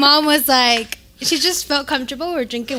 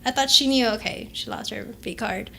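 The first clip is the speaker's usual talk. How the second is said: normal talking voice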